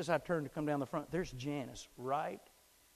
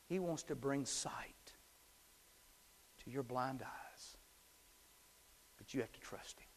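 slow near-whisper; hushed preaching; 6 dB quieter than usual. Acted. Pastor leaning in to the listener, hushed and slow. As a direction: drop to a hushed, intimate whisper.

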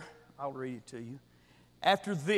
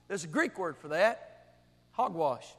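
ordinary talk

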